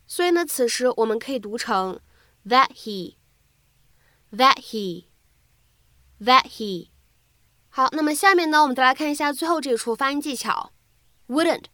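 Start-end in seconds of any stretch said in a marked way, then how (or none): 2.5-6.9 very slow "that he" with the t held and not released; unreleased t. Shown as a demonstration. Teacher showing students incomplete plosion, slow "that he". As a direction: stop the t of "that" silently before "he". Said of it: "会有一个不完全爆破的处理".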